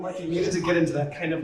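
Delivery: ordinary talk, nothing marked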